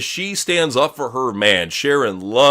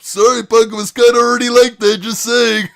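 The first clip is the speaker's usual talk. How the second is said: higher, louder teasing jab; mock Barrett taunt; 9 semitones higher, 7 dB louder than usual. Barrett taunting Punk about his nose, mock loud jab. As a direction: rub it in with a sneering shout.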